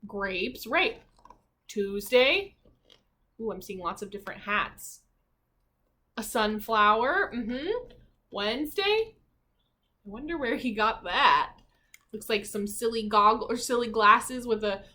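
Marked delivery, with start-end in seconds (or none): none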